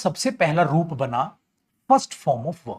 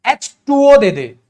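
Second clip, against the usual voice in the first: louder, slow customer asking for "H two O"; mock shop request; 4 semitones higher, 9 dB louder, slower than usual. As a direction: act out asking the shopkeeper. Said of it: "आपने दुकान पे जाके बोला". (दुकान पर "H two O" माँगता ग्राहक, ज़ोर से)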